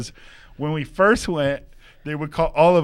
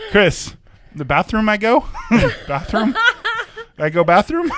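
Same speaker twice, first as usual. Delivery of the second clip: put-on high, questioning broken-English accent, breaking into laughter; hammed-up broken English through giggles; imitated broken English; 9 semitones higher, 5 dB louder than usual. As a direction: mimic broken English, louder and higher. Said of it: "I started talking in broken English".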